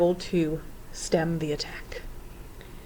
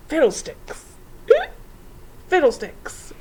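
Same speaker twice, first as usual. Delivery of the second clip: high-pitched exclamation broken by a hiccup; grumbling; 10 semitones higher, 3 dB louder, slower than usual. A narrator's reading. Professor cursing amid the din, grumbling exclamation. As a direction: grumble it as an exasperated curse. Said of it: "the professor grumbled".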